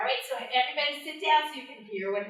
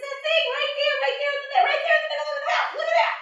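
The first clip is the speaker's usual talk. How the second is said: high, louder, pushy Anansi pointing; eager insisting; 5 dB louder, much faster than usual. Acted. Anansi urging the bush deer to look, high and insistent.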